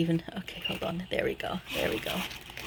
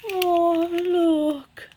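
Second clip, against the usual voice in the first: high, drawn-out coo of delight; real high-pitched adoring reaction; 8 semitones higher, 4 dB louder than usual. Real, not acted. Cooing over a cute find.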